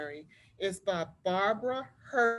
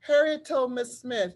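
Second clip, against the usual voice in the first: put-on lively read-aloud voice for children; story narration; 3 semitones higher, 4 dB louder than usual. A narrator's reading. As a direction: tell it like a storybook to a class. Teacher reads picture book aloud.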